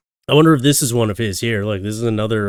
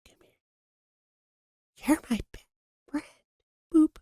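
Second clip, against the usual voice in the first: whispered close into the ear; right in your ear; 5 dB quieter, slower than usual. Pretend. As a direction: lean in and whisper it, intimate. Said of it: "I got something to whisper in your ear".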